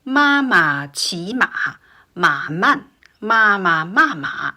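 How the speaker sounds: careful, separated syllables with exaggerated Mandarin tones; four-tone tongue twister. Shown as a demonstration. Teacher practising the tongue twister, exaggerated tones on every "ma". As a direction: make each tone distinct in "Ma ma qi ma". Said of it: "four tones with a very famous".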